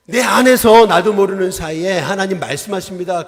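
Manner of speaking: loud, driving sermon voice; a pastor urging the church to argue back at the devil, loud and fervent; fiery preaching. Acted. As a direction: preach it out to the whole congregation. Preaching at full force.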